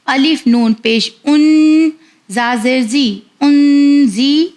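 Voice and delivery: ordinary talk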